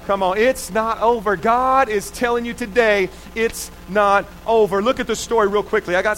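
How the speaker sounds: a pastor preaching hope. Acted. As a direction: preach it to the room. Preaching to congregation.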